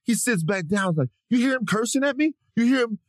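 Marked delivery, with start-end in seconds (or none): 1.3-3.0 high, aggrieved appeal to witnesses; mock victim outrage. Gym guy appealing to onlookers after sitting down, mock victim outrage. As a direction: play the victim, pitched way up. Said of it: "he right into victim".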